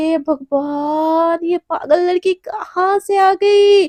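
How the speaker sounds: slow, drawn-out cry of exasperation; exasperated lament. Acted. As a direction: stretch it out like a despairing plea to heaven. Exasperated cry to God about partner. (झुंझलाकर खींची हुई दुहाई)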